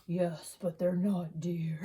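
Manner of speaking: low eerie whispered line; creepy whisper. Retold or imitated. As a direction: whisper it like a ghostly cat. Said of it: "spoke to him and said".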